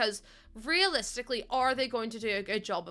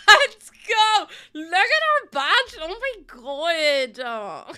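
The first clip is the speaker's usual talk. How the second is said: high, loud, stretched-out cheer; excited shouting; 6 semitones higher, 10 dB louder, much slower than usual. Real, not acted. Streamer cheering their new badge, excited shouting. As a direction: shout in celebration, drawing out each phrase.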